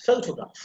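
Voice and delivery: "photograph" with first O gliding from schwa into U; O as a diphthong. Shown as a demonstration. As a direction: say "photograph" with an OH-oo glide in the first syllable. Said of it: "This O means, uh, short vowel and U sound".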